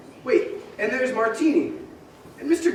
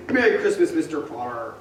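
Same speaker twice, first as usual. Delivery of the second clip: usual speaking voice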